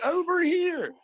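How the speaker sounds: high-pitched, frantic retold holler; calling out. Running through the yard to firefighters, high calling voice. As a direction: call high across a yard.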